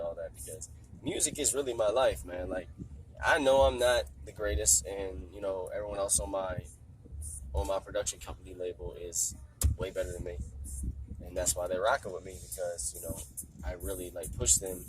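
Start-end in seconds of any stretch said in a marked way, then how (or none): none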